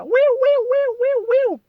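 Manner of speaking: very loud, high wailing siren noise; piercing vocal siren; imitated siren. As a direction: wail like a motorcade siren. Imitates the speeding motorcade siren.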